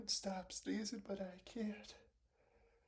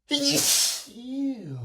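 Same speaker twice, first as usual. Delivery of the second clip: loud sneeze; 4 semitones higher, 16 dB louder than usual. Real, not acted. Sneezing on camera.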